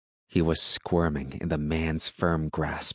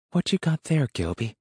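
lightly voiced country woman, higher pitch; muttering woman; 9 semitones higher than usual. A narrator's reading. Woman asks the farmer about his captive, lightly muttered. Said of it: "she muttered".

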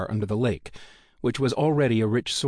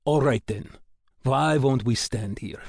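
put-on Russian accent; 3 semitones higher than usual. A narrator's reading. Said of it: "Yevchenkov's rolling accent".

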